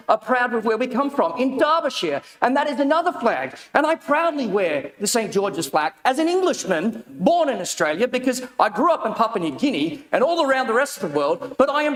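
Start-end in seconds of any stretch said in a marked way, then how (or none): none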